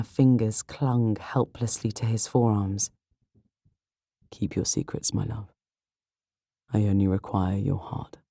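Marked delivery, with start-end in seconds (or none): 4.3-8.2 soft, low murmur to his love; hushed romantic hero; tender lover. Acted. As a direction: lower the voice intimately, gently. Lover's tender words after a kiss.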